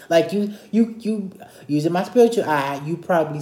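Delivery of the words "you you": normal talking voice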